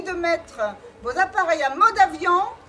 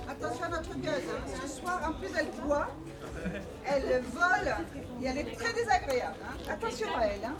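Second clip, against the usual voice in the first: aside mise en garde; 5 semitones lower, 11 dB quieter, faster than usual. Acted. Mise en garde glissée au public.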